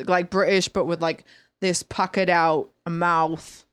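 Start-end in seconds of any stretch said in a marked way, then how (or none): 1.6-3.6 slow, puckered-lip Australian accent. Shown as a demonstration. Guest explaining the mouth shape, puckered Australian accent. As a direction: pucker the lips and draw out Australian vowels. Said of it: "You gotta kinda pucker your lips out".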